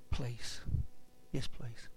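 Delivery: very low, soft plea; meek acceptance. Retold or imitated. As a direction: answer small and quiet, humbly accepting. Meekly accepting the surgeon's offer.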